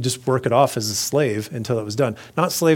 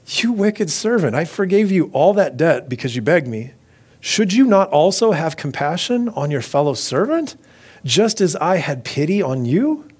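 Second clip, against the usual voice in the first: raised-pitch rebuke, read aloud; angry master; 5 semitones higher, 3 dB louder than usual. A narrator's reading. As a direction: scold the servant with measured indignation. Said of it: "his master was angry".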